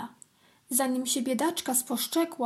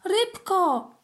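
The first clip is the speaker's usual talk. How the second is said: high, loud, delighted ant calling out; joyful call; 7 semitones higher, 6 dB louder than usual. A narrator's reading. Ant spots a little fish, calls joyfully. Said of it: "zawołała uradowana".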